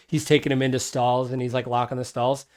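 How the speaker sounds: ordinary talk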